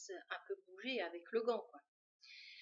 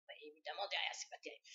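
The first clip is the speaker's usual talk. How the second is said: whispered aside; 7 semitones lower, 5 dB quieter, much faster than usual. Real, not acted. Aside about the back of the glove.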